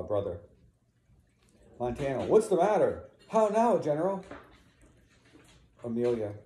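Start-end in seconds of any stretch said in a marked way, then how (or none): 2.3-4.2 high, raised urgent questions; alarmed questioning. Acted. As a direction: burst in demanding to know. Rescuers come running in alarm.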